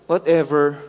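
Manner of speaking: mock bored brush-off; dismissive teen. Preacher imitating a child's brush-off to a parent, dismissive. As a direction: toss it off like a sulky kid. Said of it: "Those are disrespectful".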